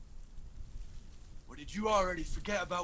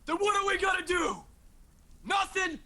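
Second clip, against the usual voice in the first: pitched up and pushed, fast and indignant; frustrated outburst; 9 semitones higher than usual. Acted. Son protests to the elders, fast and indignant. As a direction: demand an answer, exasperated and quick.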